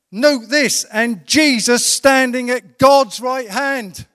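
high, loud sermon delivery with weighty emphasis; full-force preaching. Acted. Preacher telling the congregation of Stephen's vision, full-force preaching.

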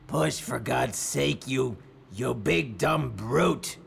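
slow, scornful villain voice; sneering villain. Acted. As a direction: spit insults at the brute. Nottingham scolding his henchman.

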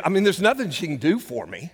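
normal talking voice

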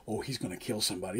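low, quick murmur among the crew; hushed aside. Acted. Crew leaving the angry boss's briefing, hushed aside. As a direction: say it under your breath, low and fast.

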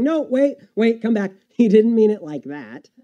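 pleading call back. Pretend. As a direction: call after the people leaving, anxiously. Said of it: "My knee-jerk reaction is to say".

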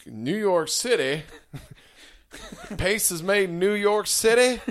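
high, loud Texas cowboy cry; mock Texan outrage. Parodying the salsa ad, loud Texan indignation. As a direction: exclaim it like an appalled cartoon Texan, laughing.

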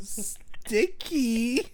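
deadpan it like the roommate; mock drowsy sticky. Friend teasing Ryan with the line, mock groggy. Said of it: "if I went up to you and I went".

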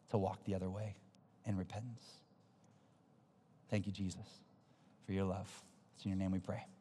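soft, low praying voice; hushed, reverent and unhurried; closing prayer. Real, not acted. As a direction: pray quietly for the congregation.

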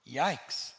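ordinary talk

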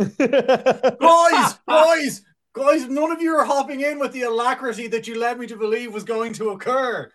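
loud, high-pitched mock outrage, slowing at the end; hammed-up protest at his friends; mock indignant shout. Complaining the friends won't back him up, hammed-up loud outrage.

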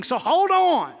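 high, loud rallying cry; preaching shout. Real, not acted. Preacher to church, a loud high rallying shout. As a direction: lift the church up, calling out loud.

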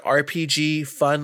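ordinary talk

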